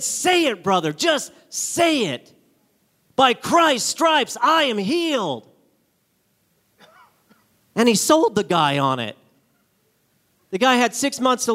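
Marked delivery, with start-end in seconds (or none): 0.0-5.5 higher, slower insistent coaxing; urging. Retold or imitated. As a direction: press him to say it. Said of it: "He said".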